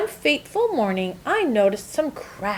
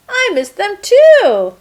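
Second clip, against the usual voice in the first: joyful; 11 semitones higher, 9 dB louder than usual. Acted. Joyful return to the carton.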